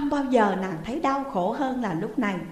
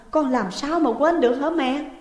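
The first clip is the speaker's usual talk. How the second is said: higher, louder sobbing plea; crying harder; 5 semitones higher, 5 dB louder than usual. Acted. Daughter crying to mother, louder anguished plea. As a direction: plead through tears. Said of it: "Dung càng khóc to hơn".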